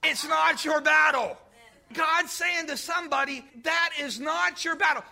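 full-force preaching climax; preaching shout. Real, not acted. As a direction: drive it home to the congregation.

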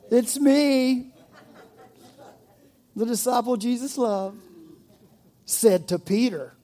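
high, loud mock boast, with a laugh in it; showing off playfully as the favourite; boastful disciple. Mimics John boasting as he writes.

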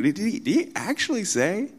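high, scoffing question; mocking. Satan scoffing at God's word, high mocking question. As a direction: sneer at the very idea. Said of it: "He's mocking it".

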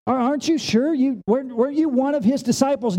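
high-pitched, insistent questioning; pressing accusation. Acted. Preacher acting a bystander questioning Peter, pressing accusation.

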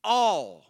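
"all" punched loud after a pause; "all" rung out as the key word; stress on all. Shown as a demonstration. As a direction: hit the word "all" hard and alone. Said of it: "I tried to stress the word all here".

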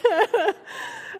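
loud high burst of laughter; big real laugh. Real, not acted. Mother laughing aloud at her daughter's egg story.

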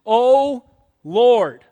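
slow, loud, fervent cry to God; dying father's loud, drawn-out prayer. Retold or imitated. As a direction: plead slowly, rising to full voice on Lord. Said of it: "He cries out for salvation".

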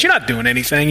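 low, dry sarcastic put-down. Retold or imitated. Preacher voicing Jesus dismissing easy love, sarcastic. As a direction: drop the pitch for a mock dismissal. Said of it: "almost hear the sarcasm in his voice".

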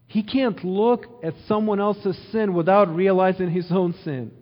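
normal talking voice

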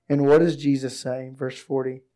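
ordinary delivery

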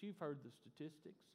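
ordinary talk

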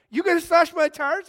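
high, loud disbelieving cry; can't believe what he's hearing; shocked disbelief. Retold or imitated. To the buddy who was paid, high loud shock. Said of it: "I'm like".

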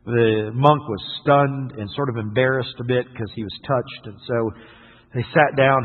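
usual speaking voice

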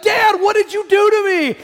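high, loud mock wail of protest; shouted, high-pitched outrage, hammed-up; exasperated teen outburst. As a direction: re-enact a panicked daughter yelling at Dad. Daughter's outburst retold in stalled car.

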